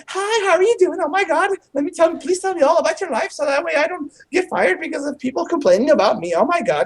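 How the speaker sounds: high, sugary put-on waitress chatter; gushing waitress voice. To the hosts, mocking a gushing server in a high sugary voice. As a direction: gush like a server desperate for tips. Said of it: "But the accent you use".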